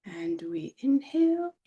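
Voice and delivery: INHALE drawn out on a rising pitch; guided breath-in cue. Pretend. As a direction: lift "inhale" slowly upward, like filling the lungs. Channeled meditation, cueing breath in.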